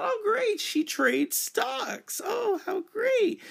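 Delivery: very high, loud, drawn-out fake enthusiasm; mock delight. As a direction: gush with exaggerated false joy. Acted sarcastic reaction to misspelling.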